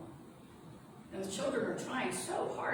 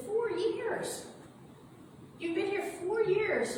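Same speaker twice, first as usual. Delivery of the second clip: higher, a touch excited; Meg answering; 12 semitones higher, slower than usual. Acted. Meg telling her father how long he was gone, higher-voiced. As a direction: tell him gently but urgently. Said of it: "She said".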